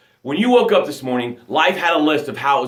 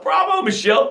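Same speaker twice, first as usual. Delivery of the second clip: high bright call of bravo; cheering; 10 semitones higher than usual. Real, not acted. Coach cheering Michelle for her comment, excited.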